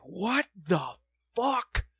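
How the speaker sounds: slow, drawn-out indignant demand; re-enacted slow, emphatic outrage; angry confrontation. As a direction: space out each word in disbelief. Retold confrontation with pushing kid.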